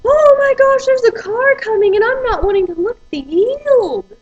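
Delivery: high, loud put-on voice of the panicked uncle; imitated panicked uncle. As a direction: play the uncle yelping in alarm. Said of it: "And then he's like".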